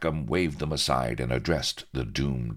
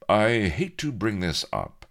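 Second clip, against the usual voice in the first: casual; 6 semitones higher, 3 dB louder than usual. Acted. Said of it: "he remarked casually".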